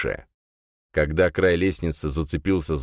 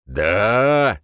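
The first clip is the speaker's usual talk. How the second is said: growling; 4 semitones higher, 5 dB louder than usual. A narrator's reading. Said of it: "зарычал Рин".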